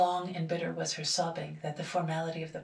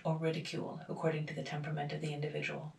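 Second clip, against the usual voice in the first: plain reading voice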